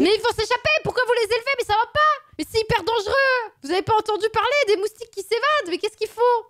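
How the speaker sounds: high, frantic alarm; mock panic. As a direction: shriek at the danger, fast. Panics at mosquitoes being bred.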